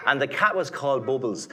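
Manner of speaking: ordinary delivery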